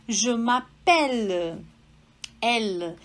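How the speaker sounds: slow m'appelle with an open E, mapɛl. Shown as a demonstration. Teacher to student: slow French m'appelle. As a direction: draw out m'appelle to show the open E. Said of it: "vamos lá".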